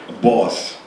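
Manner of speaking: ordinary talk, nothing marked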